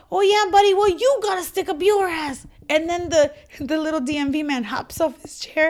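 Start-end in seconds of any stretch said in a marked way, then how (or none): 0.1-2.5 high, loud insult yelled back; shouted taunt. Retold or imitated. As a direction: repeat the jab louder and higher, mocking. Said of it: "He said".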